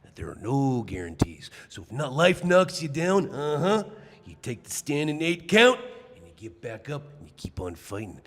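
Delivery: raspy wrestling promo voice; Macho Man Randy Savage impression. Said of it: "your best wrestler impression".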